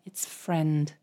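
"friend" said correctly as one syllable, frend; low, plain correction, "friend" rhyming with "end"; teacher's correction. Retold or imitated. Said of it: "you're like".

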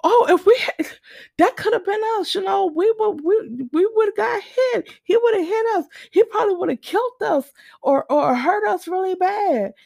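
very high-pitched, panicky imitation; retold screaming panic. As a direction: imitate a friend shaken by a near miss. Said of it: "we're screaming".

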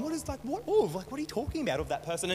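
baffled mock complaint, starting high then dropping quickly; imitated frustration. Preacher voicing the texting partner's confusion, frustrated. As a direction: imitate someone losing patience, lightly. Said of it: "she's getting frustrated at me".

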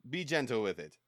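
usual speaking voice

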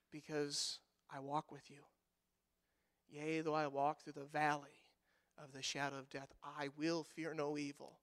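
soft, low, hushed reading of scripture; psalm recitation. Acted. Pastor quietly reciting Psalm 23 to comfort the grieving. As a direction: breathe the psalm like a prayer.

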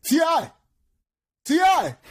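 high yelled name, imitating a friend; insistent calling out. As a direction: keep hollering to get his attention. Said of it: "So Owen keeps calling".

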